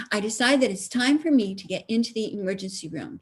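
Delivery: normal talking voice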